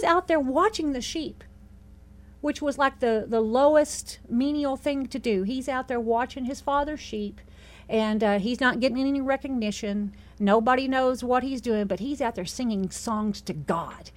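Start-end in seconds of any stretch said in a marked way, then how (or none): none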